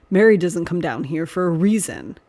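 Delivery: ordinary delivery, nothing marked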